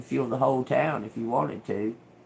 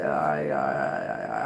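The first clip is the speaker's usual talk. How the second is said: reluctant giving in; 13 semitones lower than usual. Pretend. Acted giving in to God.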